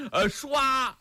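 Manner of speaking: storyteller's whip-fast swish; spear swish. Acted. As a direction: snap out a spear whoosh. Spear shake sound effect.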